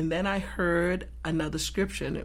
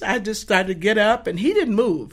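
ordinary talk

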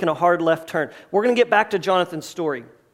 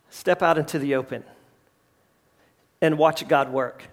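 quiet earnest; 7 semitones lower, 5 dB quieter than usual. Acted. Preacher voicing what kingdom eyes say, quiet and earnest. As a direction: lower and softer, calm conviction.

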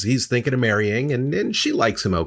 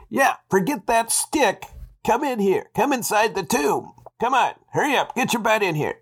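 very high, strangled creature voice; muffled voice; 11 semitones higher than usual. Acted. Vampire coaxes Kate into the tomb, muffled voice. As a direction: urge her inside in a high, muffled character voice. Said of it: "a muffled voice".

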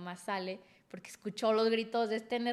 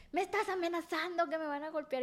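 put-on indignant drunk boyfriend; 7 semitones higher than usual. Boyfriend yelling in the street.

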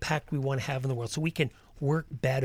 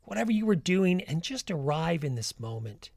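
guided meditation. Acted. Leads a walking meditation.

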